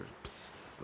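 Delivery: a long unvoiced hissing pss like a stream of pee; pissing noise. Recreating the stranger's pissing for listeners, a hissing pss. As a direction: mimic the sound of her pissing, breathy hiss. Imitating her pissing sound.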